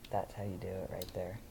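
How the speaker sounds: ordinary delivery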